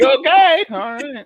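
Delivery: tiny high-pitched comic squeak; mock-cheery acceptance. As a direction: squeak out a retreat after being rebuffed. Retold meek reaction to rejection.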